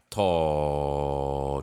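to stretched long with no final stop; to held long, no k to stop it; drawn-out vowel. Shown as a demonstration. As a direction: let to ring on and on. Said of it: "这个音会变成to".

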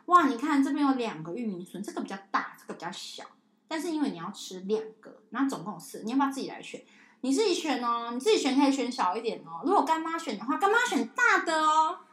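put-on high, bright-up sing-song to a child; playful bargaining. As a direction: sell the choice like a game. Playful offering choices to child.

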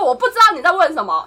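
带着火气的不耐烦; 提高音量顶回去; 情绪上来. Retold or imitated. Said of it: "我就情绪上来". (情绪上来地重说一遍)

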